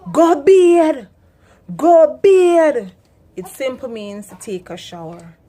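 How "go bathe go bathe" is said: loud, higher shouted Patois, go bathe said go bid; Jamaican yell. Retold or imitated. Inner-city parent yelling at kids to wash. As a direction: shout it across the yard. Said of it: "whole heap of noise".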